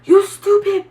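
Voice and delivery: lightly voiced angry man, high and mocking; a hint of a mock road rage rant; angry driver cursing. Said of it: "he was like".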